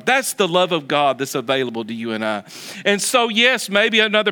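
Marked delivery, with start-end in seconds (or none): none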